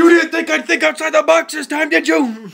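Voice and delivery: high, very loud gloating; hammed-up hero yelling a taunt; triumphant mock shout. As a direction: crow it at the top of your voice. Player shouts back at monster.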